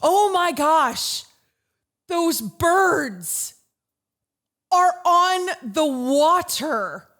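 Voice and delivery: loud, high, drawn-out mock scream; retold scream. Host re-enacting her panic at birds on the water, screaming. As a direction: re-enact screaming in shock, stretching the words. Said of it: "And I start screaming".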